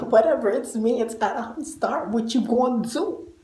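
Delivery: sassy diva swagger. Real, not acted. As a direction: toss it off with attitude. Sassy self-intro, laughing.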